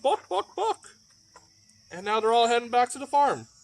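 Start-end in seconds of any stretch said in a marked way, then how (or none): 0.0-0.9 high laughing book book book; laughing hen cluck. Acted. Hen gets her books, clucking high with a laugh. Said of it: "She went".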